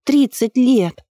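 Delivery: high-pitched acted outcry; indignant exclamation. A narrator's reading. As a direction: exclaim it in reproach, pitch jumping up. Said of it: "воскликнула она".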